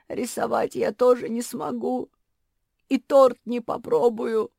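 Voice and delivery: high, loud, mildly tearful voice; tearful lament. A narrator's reading. Куки thanks family for gifts she cannot use, lamenting. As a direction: lament it as if close to crying.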